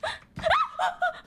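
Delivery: very high startled gasp; real jolt of fear; sharp frightened gasp. Real, not acted. Sudden scare.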